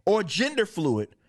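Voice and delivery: high-pitched mock recital; resume the reading high and pointed; mock comic dialogue. Host reading on from the comic, mocking high voice. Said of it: "and this is what they say".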